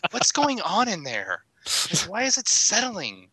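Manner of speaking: slow, high, puzzled worry; worried. Retold or imitated. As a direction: ask it slowly and uneasily. Worried at settling PS3.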